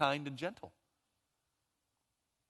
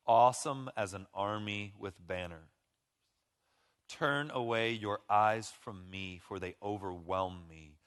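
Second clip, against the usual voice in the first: slow, tender reading of the Song; scripture reading; 3 semitones lower, slower than usual. Acted. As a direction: savour the verses. Reads Song of Songs aloud.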